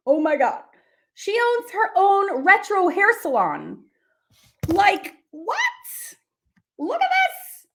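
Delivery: thrilled disbelief; excited squeal; amazed. Real, not acted. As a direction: pitch jumps up in delight. Amazed by the hair salon.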